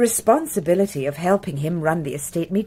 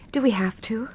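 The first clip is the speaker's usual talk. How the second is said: weary whisper; 3 semitones higher, 3 dB quieter than usual. Acted. Weary plea to avoid painful subject.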